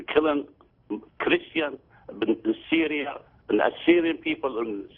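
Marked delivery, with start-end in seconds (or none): none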